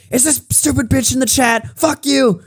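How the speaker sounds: high-pitched indignant retelling of a rant; retold angry outburst. Streamer retelling how they confronted her in chat, indignant.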